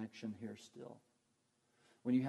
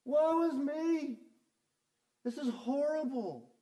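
high, loud, drawn-level whine of lament; wailing grumbler; 10 dB louder than usual. Acted. Preacher acting the Israelites grumbling against the Lord, loud high-pitched wail. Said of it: "Is that not grumbling?".

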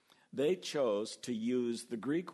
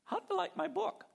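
much higher, eager and fishing for praise; eager Habakkuk; faster than usual. Retold or imitated. Prophet Habakkuk greets a believer in heaven, eagerly high. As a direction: ask like an author hungry for compliments. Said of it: "And he's gonna say".

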